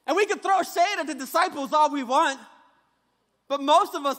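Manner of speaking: ordinary talk, nothing marked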